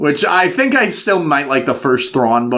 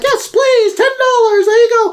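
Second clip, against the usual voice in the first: put-on much higher, louder squeaky child voice; excited kid; 3 dB louder than usual. The child thanking his mother, squeaky and excited. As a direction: squeak it like a happy little kid. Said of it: "And you're like".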